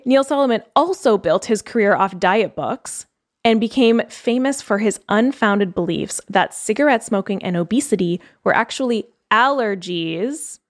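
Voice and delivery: normal talking voice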